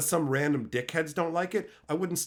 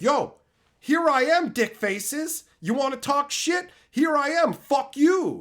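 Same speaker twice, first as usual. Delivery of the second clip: higher and louder, a hammed-up taunt; mock taunting yell; 9 semitones higher, 5 dB louder, slower than usual. Streamer retelling how he taunted his haters, loud and mocking. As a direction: throw it at them like a challenge.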